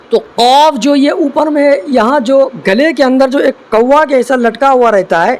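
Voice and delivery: normal talking voice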